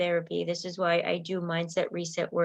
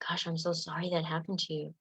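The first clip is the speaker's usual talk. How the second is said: soft sympathy; 8 dB quieter than usual. Shown as a demonstration. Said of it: "if somebody was in a car accident".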